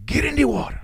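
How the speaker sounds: high, loud mock Puerto Rican accented bark; Puerto Rican instructor. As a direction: play the Puerto Rican instructor barking the order. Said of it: "And that's how it sounded".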